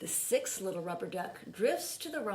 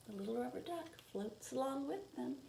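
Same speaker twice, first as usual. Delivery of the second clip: "The little rubber duck floats along with them" repeated much softer; soft echo; 5 semitones higher, 8 dB quieter than usual. A narrator's reading. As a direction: repeat the line as a hushed echo. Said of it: "and the little rubber duck floats along".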